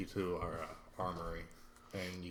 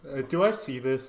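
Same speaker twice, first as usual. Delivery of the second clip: laughing; 6 semitones higher, 10 dB louder than usual. Real, not acted. Players laughing at stammering drow.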